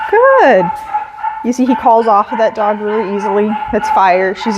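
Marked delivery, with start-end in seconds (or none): 0.1-0.7 bright, high, loud "good"; high praise. Real, not acted. Trainer calling out praise to her dog, high and loud.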